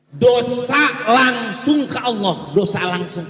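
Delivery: emphatic shout. Real, not acted. Preacher stressing sin types.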